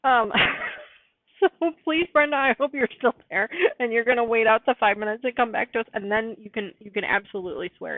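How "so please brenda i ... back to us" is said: ordinary delivery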